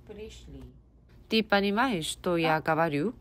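ordinary talk, nothing marked

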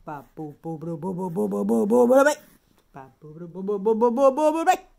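nonsense boo-boo babble climbing in pitch and volume; silly babble. Real, not acted. Parent clowning to stop a baby crying, silly babble. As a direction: rattle off boos, building from low to loud.

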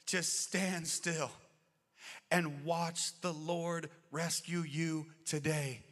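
slow, rising to loud, high proclamation; building preaching. Acted. Preacher proclaiming Moses' words to the congregation, slow and swelling loud. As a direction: start slow, then build to a loud ringing cry.

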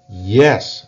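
sharp loud "Yes" to stop the list; a mother's loud "Yes" silencing the kids; firm interruption. Acted. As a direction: cut them off firmly and loudly. Mama cuts the children off.

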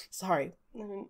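very soft, small apology; hushed, embarrassed, trailing away; caught crying. Acted. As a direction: barely get the apology out. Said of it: "frantically, like, wiping at his face".

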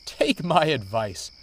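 tickled, cheeky counsel; chuckling. A narrator's reading. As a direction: say it with a light chuckle. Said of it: "chuckled Thomas".

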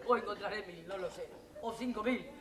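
quiet, defeated trailing off; fading into despair. Acted. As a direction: let the bravado drain out. Voice fading as she gives up.

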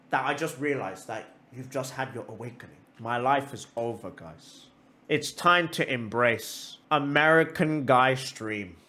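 mock defeat. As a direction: give up theatrically, voice sinking low. Mock confession that life is over.

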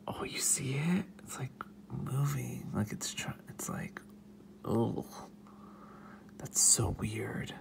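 hushed whispering, fascinated. Real, not acted. Whispering while watching the wasp up close.

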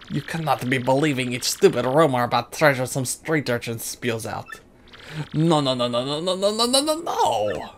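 hammed-up French trader; silly French accent. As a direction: keep the mock French accent going. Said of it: "the stupid French voice".

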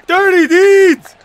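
loud, high, drawn-out shout; real stretched-out high yell; battle cry. Real, not acted. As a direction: bellow the finishing move slowly. Calling out a finishing move.

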